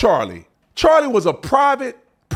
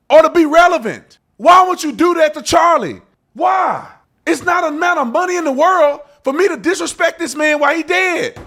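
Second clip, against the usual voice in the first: loud, high, real anger; outraged shout; 6 semitones higher, 3 dB louder than usual. Real, not acted. Host shouting down people cashing in, outraged. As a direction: yell it at the people using his name.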